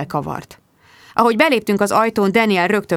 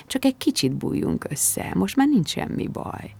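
soft coaxing; 4 semitones higher, 6 dB quieter than usual. A narrator's reading. Daniel coaxes Bridget to cuddle up, soft soothing voice. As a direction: coax her gently to settle.

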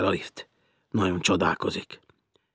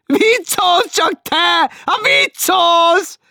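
much higher, loud and slow nagging; shrill teasing woman; 12 dB louder, much slower than usual. Acted. Woman below teasing Martin, shrill and slow. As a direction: drag it out like a shrill landlady.

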